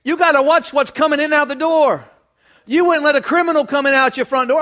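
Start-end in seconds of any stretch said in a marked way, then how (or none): none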